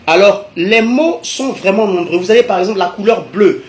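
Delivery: normal talking voice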